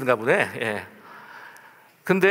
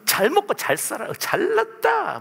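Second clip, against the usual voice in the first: mocking scorn. Spitting insult at the street vendor.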